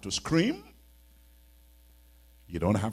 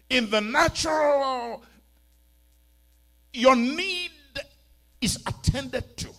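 high, loud pulpit exhortation; impassioned preaching; 11 semitones higher, 11 dB louder than usual. Real, not acted. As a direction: urge the congregation on with rising fervour.

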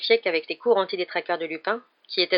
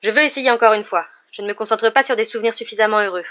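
louder, raised pleading to retry; insistent protest; 3 semitones higher, 7 dB louder than usual. Acted. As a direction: insist on another attempt. Harry insists on trying again. (protestation insistante)

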